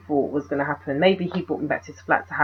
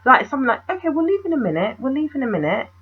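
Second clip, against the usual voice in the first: lightly voiced odd man, higher; weird man imitated; 7 semitones higher than usual. Retelling how the stranger stalled her, a higher mock voice. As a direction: mimic his strange stalling, pitched up. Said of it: "weird about it and going".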